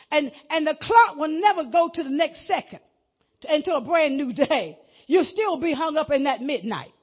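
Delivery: preaching at full force; booming motivational speech. Acted. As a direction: build it up like a revival preacher.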